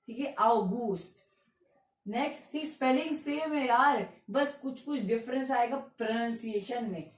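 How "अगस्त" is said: slow अगस्त as "ow-GOOST"; German pronunciation. Shown as a demonstration. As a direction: draw out अगस्त, "au" then long "oo". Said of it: "अगस्त नहीं".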